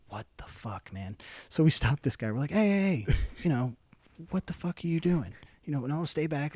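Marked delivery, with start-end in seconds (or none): 0.1-1.2 very soft, dumbfounded aside; stunned disbelief. Retold or imitated. Repeats stunned reaction to the sight. 2.5-6.5 higher-pitched, wary challenge; retold challenge. Retold challenge to man with hatchet.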